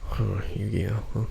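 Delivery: ordinary delivery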